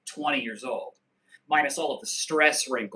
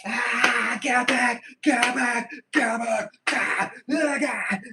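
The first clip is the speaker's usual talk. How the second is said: demo aggressive shout; 8 semitones higher, slower than usual. Shown as a demonstration. Demonstrating Krav Maga shouting to viewers, high aggressive yell. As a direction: show how to shout them off. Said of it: "Be aggressive".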